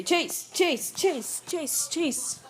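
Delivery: high repeated call of the name; calling a dog. Real, not acted.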